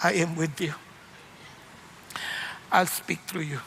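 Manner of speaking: low, soft, hushed voice of God; God speaking gently to the struggling preacher, low and soft; voice of the Lord. Retold or imitated. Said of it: "Ang sabi ng Lord".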